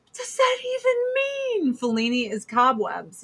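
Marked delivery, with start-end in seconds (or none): 0.0-1.8 very high mock bewilderment; exaggerated high-voiced confusion; puzzled friends. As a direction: imitate baffled friends in a squeaky, pitched-up voice. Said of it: "my friends were like".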